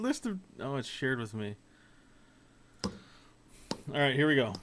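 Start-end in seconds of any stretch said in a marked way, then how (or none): none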